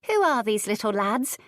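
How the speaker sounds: lightly voiced curious little girl; a hint of a child asking, curious. A narrator's reading. Girl asks her nurse about the boys, curious.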